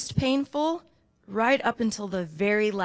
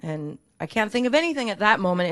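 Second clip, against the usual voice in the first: ordinary talk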